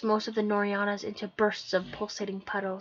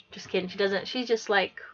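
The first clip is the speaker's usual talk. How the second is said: ordinary delivery, nothing marked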